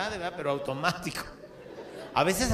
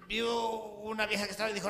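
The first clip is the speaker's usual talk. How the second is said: ordinary talk, nothing marked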